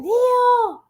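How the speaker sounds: high, drawn-out excited shout; thrilled high-pitched yell; excited scream. Retold or imitated. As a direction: scream the news to the next room. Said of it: "I screamed to my husband".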